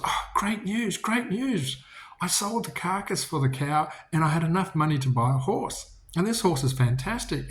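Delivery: high, bright-eyed news of a bargain; excited farmer. Acted. Farmer brings good news.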